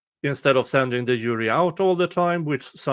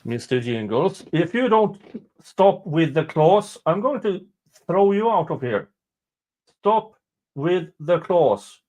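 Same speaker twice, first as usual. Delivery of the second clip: stern pet scolding; 4 semitones higher than usual. Real, not acted. Streamer threatens to throw cat out, scolding. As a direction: lay down the law to a cat.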